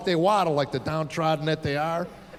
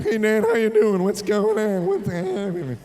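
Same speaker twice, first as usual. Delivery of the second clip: put-on cracking teen voice; 3 dB louder than usual. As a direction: play the eleven-year-old trying to sound grown. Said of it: "He's going".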